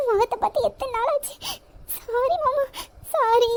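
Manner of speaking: put-on girl apologizing while crying; tearful sorry. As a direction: whimper sorry through tears.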